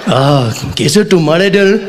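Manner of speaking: slow, careful learner's Hindi with a laugh; comic rehearsing Hindi pick-up lines in the mirror; practising Hindi. Pretend. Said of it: "I was".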